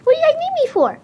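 bright eager sidekick greeting the gang; Tails voice. Acted. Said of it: "Hi, Tails".

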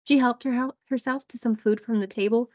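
usual speaking voice

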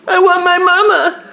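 put-on high, slow whiny baby voice; mock baby whine. Dad voicing his crying baby, high slow whine. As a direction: whine like the baby wanting mom. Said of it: "So you're trying to say".

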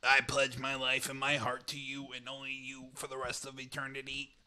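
stiff robot voice pledging vows. Pretend. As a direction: speak your vows like a robot. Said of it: "saying this in a, like, a voice".

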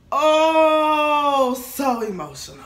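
high, loud mocking "oh"; mock emotional wail. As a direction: ham up an over-emotional sigh. Mocking Cancer people's emotions.

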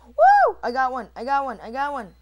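high whoop, then loud excited repeats; elated cheer. Real, not acted. Player finally getting a kill, loud excited cheer. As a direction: burst out in real triumph.

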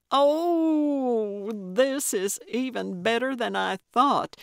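long swooping high "Oh", then eager; delighted thrill. A narrator's reading. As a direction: savor the juicy news. Gertrude delighted to hear of murder.

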